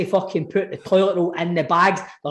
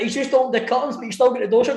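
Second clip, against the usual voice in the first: usual speaking voice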